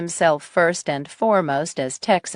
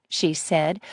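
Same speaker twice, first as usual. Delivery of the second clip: ordinary delivery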